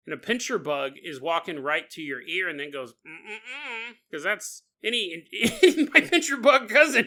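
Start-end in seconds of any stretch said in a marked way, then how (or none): 3.1-3.9 bug humming. Acted. Pincher bug walking to ear.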